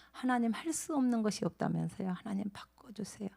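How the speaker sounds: 하나님, 할 수 whispered softly as a plea; hushed pleading prayer to God. Acted. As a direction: breathe the prayer in a hush.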